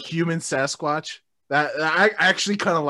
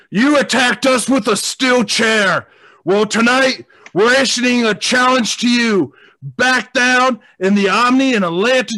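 shouted, higher wrestling promo; 5 semitones higher, 5 dB louder than usual. Acted. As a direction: yell the challenge like a wrestler. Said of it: "we are going to cut a promo".

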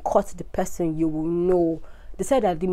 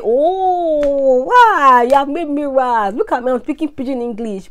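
much higher, louder squeal of delight; excited exclaiming; 7 semitones higher, 8 dB louder than usual. Retold or imitated. Retelling how she gushed to her boyfriend, high and thrilled. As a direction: gush like you just discovered something thrilling. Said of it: "I used to say".

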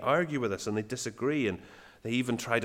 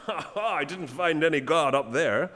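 higher-pitched jeer with a mock laugh; 4 semitones higher than usual. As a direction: poke fun with a taunting "ha, ha". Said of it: "He was poking fun".

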